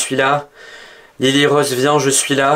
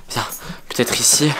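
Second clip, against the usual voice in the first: usual speaking voice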